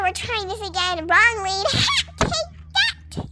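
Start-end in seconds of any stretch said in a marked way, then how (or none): 1.7-3.2 high mock taunt. Pony bucking rider.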